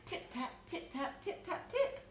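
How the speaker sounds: high, light tip-tap sounds of tiny mice; high tapping noises. A narrator's reading. Mice tapping out from under the teacups, high and light.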